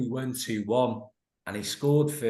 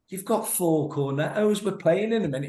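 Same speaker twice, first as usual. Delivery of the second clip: mock manager scolding; 6 semitones higher than usual. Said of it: "He goes".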